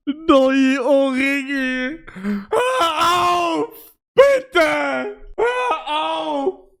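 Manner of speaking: high, crying shout of embarrassment; pleading shout. Real, not acted. Streamer begging chat to stop reading the message, crying shout. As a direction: yell it out, begging them to stop.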